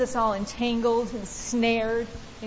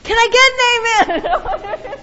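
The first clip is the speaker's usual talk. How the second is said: usual speaking voice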